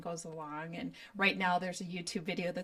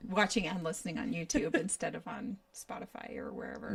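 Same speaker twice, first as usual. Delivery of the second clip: whispering; 2 semitones lower than usual. Real, not acted. Laughing aside to audience.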